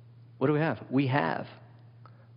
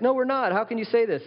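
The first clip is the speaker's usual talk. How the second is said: much higher, louder offended denial; indignant protest; 10 semitones higher, 4 dB louder than usual. Retold or imitated. Corinthians protesting to Paul, indignant and high. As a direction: protest like you're insulted. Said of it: "And they'd be like".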